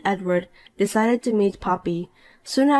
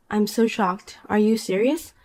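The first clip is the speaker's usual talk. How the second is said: usual speaking voice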